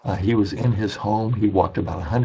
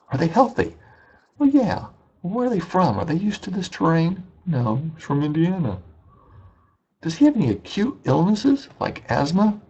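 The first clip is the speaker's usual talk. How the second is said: higher mock questioning, rising alarm; anxious questioning; 8 semitones higher than usual. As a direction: grill them like a worried local. Caretaker questioning, friend answering.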